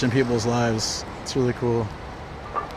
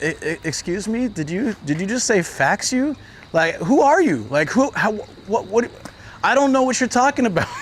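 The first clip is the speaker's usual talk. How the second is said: stammering, higher and higher in mock confusion; baffled stammer; 6 semitones higher than usual. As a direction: play it flustered and bewildered. His confused reply to the monk.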